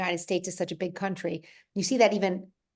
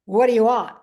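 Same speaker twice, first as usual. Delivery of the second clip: loud, blunt bark; rough counter guy; 3 semitones higher, 9 dB louder than usual. Retold or imitated. Boston rental car clerk to a waiting customer, loud and blunt. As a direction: throw it at the customer like an impatient clerk. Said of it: "they can be kind of rough sounding".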